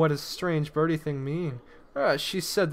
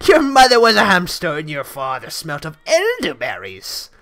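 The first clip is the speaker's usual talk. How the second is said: French taunter; 9 dB louder than usual. Retold or imitated. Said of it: "Monty Python and the Holy Grail".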